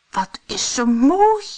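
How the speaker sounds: high, loud wonder; high-pitched admiring exclamation; admiring dwarf. A narrator's reading. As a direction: marvel at her as a little dwarf.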